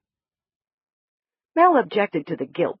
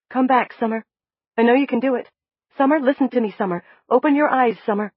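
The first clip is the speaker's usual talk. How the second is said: urgent coaxing, raised; 7 semitones higher, 5 dB louder, faster than usual. A narrator's reading. Narrator urging the waking woman to open her eyes, coaxing. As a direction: call her back gently but firmly.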